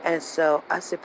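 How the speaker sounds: ordinary talk, nothing marked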